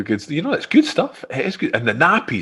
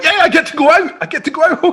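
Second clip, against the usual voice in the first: much higher and louder, laughing; mock excitement; 4 dB louder, faster than usual. Host pretending joy at a nappy run, high and laughing. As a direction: cheer it like a kid let out of school. Host acts thrilled to buy nappies.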